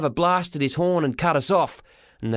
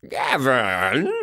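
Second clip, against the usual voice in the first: half happy half mad; 3 semitones lower than usual. A narrator's reading. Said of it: "he yelled, half happy, half mad".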